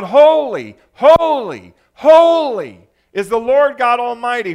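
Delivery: high, very loud cry of praise. Acted. Preacher voices seraphim crying out again and again, emphatic loud cry. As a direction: belt out each holy, long and falling. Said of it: "these creatures cry out".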